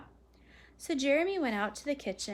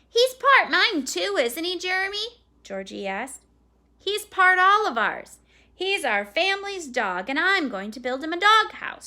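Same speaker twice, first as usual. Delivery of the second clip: lightly voiced excited kid, higher and louder; insistent young boys; 9 semitones higher, 12 dB louder than usual. A narrator's reading. Little boys claiming the family's new dog, insistent kids. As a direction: stake a claim like an eager child.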